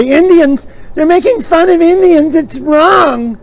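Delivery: high, loud offended complainer; hammed-up scandalised protest; mock outrage. Offended objector protesting fun made of Indians, mock outrage.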